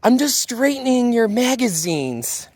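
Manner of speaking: high, mock protest from a girl; lightly voiced defiant girl shouting back; retold shout back. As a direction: pitch up and protest. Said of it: "Ellie screams back".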